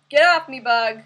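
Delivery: loud, sharp yell at a bug; startled shout. Real, not acted. Streamer yelling at a bug crawling on her, loud. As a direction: shoo it off with a yell.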